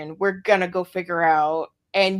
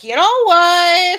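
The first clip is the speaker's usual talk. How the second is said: mock realization; 10 semitones higher, 9 dB louder, slower than usual. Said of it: "and we're like".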